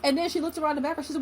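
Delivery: ordinary talk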